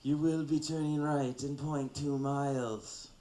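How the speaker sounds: hammed-up mafia don voice; mafia boss. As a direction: give directions like a godfather making an offer. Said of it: "maybe a mafia version?".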